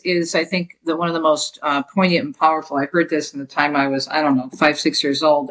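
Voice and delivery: ordinary delivery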